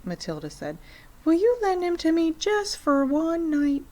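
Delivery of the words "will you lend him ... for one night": put-on higher little girl asking a favour; pleading girl. A narrator's reading. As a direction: coax Fred like a hopeful child. Matilda pleading with Fred.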